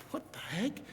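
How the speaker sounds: hushed, high-pitched double take; soft, rising gasp of disbelief in the words; stunned disbelief. Acted. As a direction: voice the servant's quiet shock. Servant stunned at sight of army.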